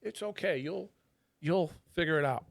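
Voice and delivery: high, soft mock Thai reassurance; imitated Thai accent. The Thai lady reassuring him about the bidet, high soft mock accent.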